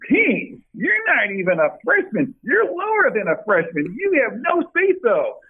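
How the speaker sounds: high-pitched little scolding coach voice; Coach Smith impression. Coach dressing down a redshirt player at practice, high-voiced imitation. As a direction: put a player in his place in a high, drawn-out little voice. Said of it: "he said it with his Coach Smith little voice".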